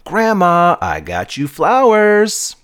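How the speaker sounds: put-on high, slow, proud kid; proud exclaim. A narrator's reading. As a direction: announce it proudly. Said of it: "proudly exclaimed".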